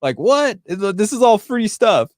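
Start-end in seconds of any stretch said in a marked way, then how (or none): none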